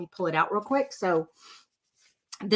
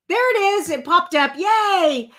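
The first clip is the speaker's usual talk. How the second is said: much higher, loud cry of relief; delighted; 9 semitones higher, 6 dB louder than usual. Real, not acted. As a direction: celebrate it finally appearing. Screen share finally pops up.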